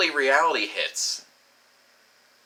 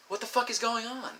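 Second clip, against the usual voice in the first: softer, much higher mock despair; high-pitched bafflement; 10 semitones higher, 5 dB quieter than usual. Baffled lover asking himself what's wrong, high-pitched exasperation. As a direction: pitch it right up, helplessly baffled. Baffled at his failure, escalating.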